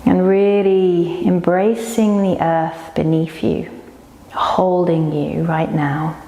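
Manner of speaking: slow, unhurried yoga nidra guiding voice; guided meditation. Real, not acted. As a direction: lead them slowly into rest, with long pauses. Leads yoga nidra relaxation.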